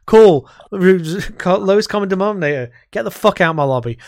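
loud scornful send-off to a whining player; mock dismissive shout. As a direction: shout them out of the lobby, scornful. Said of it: "And it's like".

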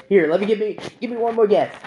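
retold high, insistent request; eager retry. Retells man asking to guess again.

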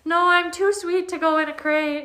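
high, laughing, sweet-talking plea; pleading dog voice. Retold or imitated. Dog pleading not to be crated, high laughing plea. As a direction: beg like a dog avoiding the crate. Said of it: "and like".

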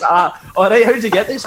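barely holding in the laugh; amused retelling. Retold or imitated. Retelling his own amused question.

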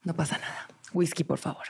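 soft, hushed self-reassurance; forced calm. Retold or imitated. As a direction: play it cool, quietly, to hide fear. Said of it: "trato de calmarme para yo no pasarle mi miedo".

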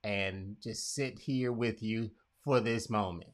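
low, soft, tender reassurance; quiet presence. Pretend. Sitting beside someone grieving, quiet and tender. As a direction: offer company gently and softly. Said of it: "just have the presence".

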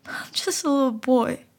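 put-on tearful child's lament; boy crying to the frog. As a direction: say it through sobs. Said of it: "I started crying again".

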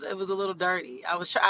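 normal talking voice

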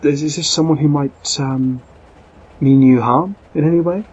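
the lady insists, alarmed and forceful; urgent lady. Acted. Lady anxiously asks about threats.

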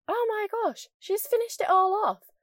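high-pitched mock-amazed mum; high, exclaiming mum impression; imitating mum. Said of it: "my mum's like".

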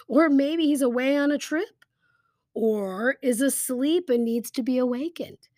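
lifted, teasing mock speculation; playfully scoffing suggestion; sarcastic mockery. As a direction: offer excuses in mock innocence. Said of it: "I love this sarcasm".

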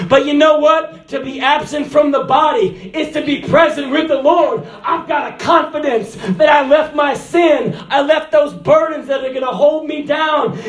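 high, loud preacher's shout; climbing to a triumphant shout; full-force preaching. Acted. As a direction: cry it out to the congregation.

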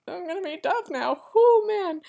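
high, loud giddy squeal; thrilled squeal. Retold or imitated. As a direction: squeal like a starstruck fan. Imagining meeting the cast, thrilled.

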